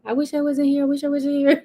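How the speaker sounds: high put-on voice of a son who wants out; mock reluctant son. The silent son at the blow-up, high mock wishing voice. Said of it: "the other son was just sitting there like".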